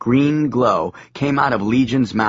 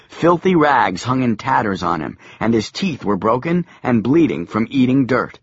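ordinary delivery, nothing marked

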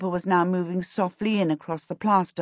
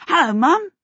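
bright, high, cheerful greeting; a carefree boy calling hello; 12 semitones higher, 6 dB louder than usual. Acted. Boy sitting up greets his mother, high and cheerful. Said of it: "he said cheerfully".

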